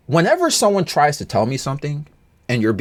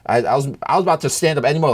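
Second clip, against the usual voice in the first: usual speaking voice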